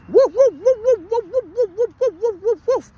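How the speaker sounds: very high-pitched rapid woofs; imitated little-dog yapping. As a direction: mimic a tiny mouthy sausage dog yapping. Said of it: "the mouth on that dog".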